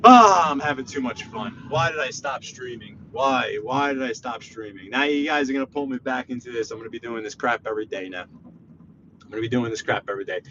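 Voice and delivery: ordinary delivery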